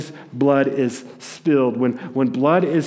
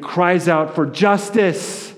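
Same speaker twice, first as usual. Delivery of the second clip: crying out; 6 semitones higher, 3 dB louder, slower than usual. Acted. Acting out blood crying for justice.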